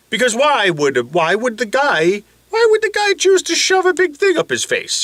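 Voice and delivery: very high, incredulous cartoon voice; baffled face. Body wonders why he would do this, baffled. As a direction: squeal in disbelief, then settle into helping. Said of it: "because the face says".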